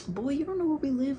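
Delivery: high-pitched mocking comeback; trolling. Kids trolling a stranger who claims their address, mocking. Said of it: "trolling him".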